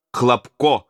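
usual speaking voice

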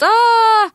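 high, long falling cry; high wail, held long; drawn-out cry. A narrator's reading. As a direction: cry out as the floor drops away. Cry while falling through hatch.

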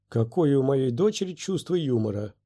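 usual speaking voice

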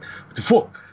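high-pitched mock shock; imitated startled yelp; startled outburst. Character startled by distant figure.

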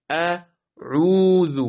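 اعوذ with a long, held عو; very slow اعوذ, medium ayn drawn out; عینِ متوسط و کشیده. Shown as a demonstration. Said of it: "حرف عین اون صفت توسط". (اعوذ را با عینِ متوسط بکش)

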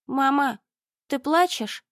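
put-on high, small child's voice; little boy voice. A narrator's reading. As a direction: ask it like a worried small boy. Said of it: "послышался голосок Артёма". (тонкий детский голосок)

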